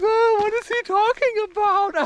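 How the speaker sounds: high, mocking baffled voice; mock bewildered whine; confused viewer. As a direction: play a puzzled viewer scoffing.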